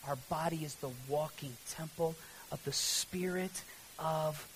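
soft, low sermon close, near a whisper at times; hushed preaching. Real, not acted. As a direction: let the sermon settle into quiet conviction.

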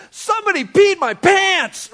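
put-on high, loud wail of a little kid; hammed-up bawling preschooler; mock sobbing child. Sobbing preschooler confesses to the principal, mock wailing. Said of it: "He's just sobbing, like, just broken".

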